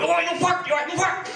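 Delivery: high taunting mock of brawling guys; mock fighting bloke. Comic mocking two guys fighting in the street, high jeering. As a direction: jeer like a bloke squaring up.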